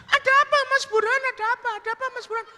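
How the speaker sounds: very high falsetto, fading from loud; insistent woman's voice. Acted. Woman asking trembling Burhan what's wrong, high falsetto. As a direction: ask repeatedly in high falsetto.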